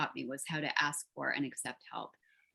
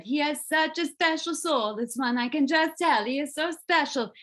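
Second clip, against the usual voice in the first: imitating tearful Italian woman; 10 semitones higher, 6 dB louder than usual. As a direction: ham up a tearful Italian woman gushing over the dog. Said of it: "this beautiful Italian woman ... cried and said".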